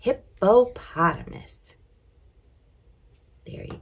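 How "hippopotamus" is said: "Hippopotamus" broken after HIP and PO; teacher carefully breaking up "Hippopotamus"; sounding out syllables. Shown as a demonstration. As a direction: say "Hippopotamus" in careful separate chunks. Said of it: "That's a big word".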